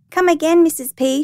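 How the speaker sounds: put-on high, loud child's call. A narrator's reading. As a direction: call after someone like a little girl. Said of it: "she called".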